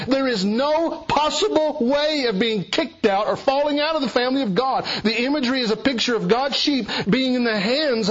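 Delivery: impassioned sermon climb about God's unbreakable grip; preaching at full force. Acted. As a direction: preach it home with rising fervour.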